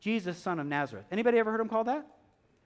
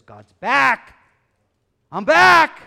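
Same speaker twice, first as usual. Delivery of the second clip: loud, high shout of return; booming, exultant proclamation; triumphant announcement; 8 semitones higher, 17 dB louder, much slower than usual. Acted. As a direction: proclaim it at full voice. Jesus announcing God's return.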